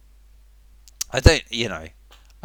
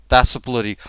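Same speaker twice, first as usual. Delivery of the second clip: ordinary talk, nothing marked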